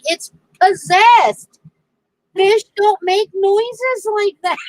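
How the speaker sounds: high, loud, drawn-out start with a laugh creeping in; mock horror. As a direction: protest in comic alarm. Retells her horrified defence.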